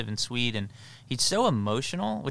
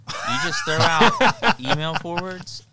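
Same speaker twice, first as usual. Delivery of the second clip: mock pro tip; 6 semitones higher, 7 dB louder, slower than usual.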